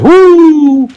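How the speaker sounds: loud high joyful whoop; high-pitched whoop of joy. A narrator's reading. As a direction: whoop with glee. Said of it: "high-pitched whoop of joy".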